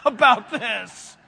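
fired-up preacher, loud and emphatic; loud, fervent pulpit cry; pumped up. Real, not acted. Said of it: "I'm pumped up about this".

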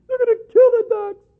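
high, loud mock sob of a weeping protester; overdone tearful cry on the news; mock wailing. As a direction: wail it, hammed-up. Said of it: "They're crying".

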